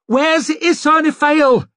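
high, slow, frantic screech of a name. Acted. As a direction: scream for her across the camp. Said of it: "he screeched".

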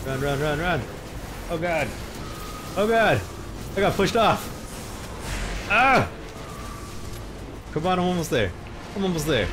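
high panicked shouting while fleeing; being chased. Real, not acted. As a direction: yell in real panic, running for your life. Fleeing, pushed off ledge.

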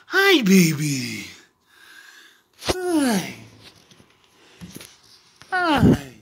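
high, excited pet-talk voice. Real, not acted. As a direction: squeal sweetly at the dog. Cooing at the dog.

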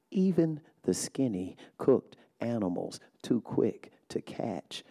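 slow, soft, measured poem reading; poem recitation. Acted. Poet reading her poem to a hushed audience, slow and soft. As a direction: let each line settle, quiet and deliberate.

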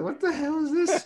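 high, laughing disbelief; shocked laugh. Retold or imitated. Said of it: "I was like".

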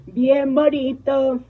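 high, loud, very slow and stretched; loud slow gushing praise; drawn-out gush. Shown as a demonstration. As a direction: stretch every syllable out, admiring. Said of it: "así, bien bonito".

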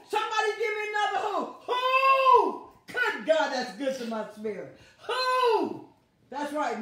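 loud, high preaching shouts; preaching at full force. Real, not acted. Preacher rousing the congregation in loud call and response. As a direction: whip up the crowd with calls.

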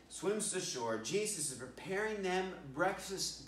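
ordinary talk, nothing marked

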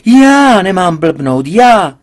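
loud, high, slow shout; father yelling back at his daughter; indignant shout. A narrator's reading. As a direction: explode at being told off. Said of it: "proč křičíš".

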